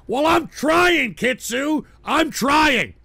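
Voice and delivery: high, loud, slow defensive cry; plead, you are doing your best; exasperated protest. Real, not acted. Protesting to a viewer who heckles him about Trump.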